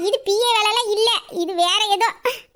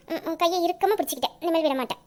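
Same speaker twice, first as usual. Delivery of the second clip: tender promise; 6 semitones lower, 5 dB quieter than usual. Acted.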